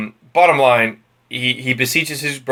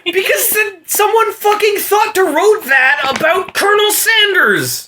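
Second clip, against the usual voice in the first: much higher, incredulous rant; agitated. Real, not acted. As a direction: still worked up in disbelief. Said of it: "agitated again".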